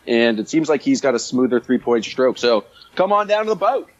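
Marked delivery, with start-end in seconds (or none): 3.0-3.9 put-on a clumsy stab at an Australian accent, pitched higher; bad Australian accent. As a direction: ham up a botched Australian invite to the boat. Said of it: "that was a terrible accent".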